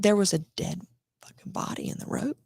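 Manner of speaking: hushed, breathy whisper. Real, not acted. Telling a friend about the body, whispered. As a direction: lean in and whisper it.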